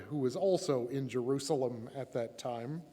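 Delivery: plain reading voice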